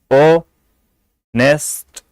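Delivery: Honest broken in two, o, long pause, nest; honest in two far-apart beats; split into syllables. Shown as a demonstration. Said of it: "इसमें दो syllable ही".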